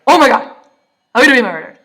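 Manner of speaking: loud, high burst of mock panic; panicked yell. Waking somewhere unknown, imitating a panicked yell. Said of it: "I panic like".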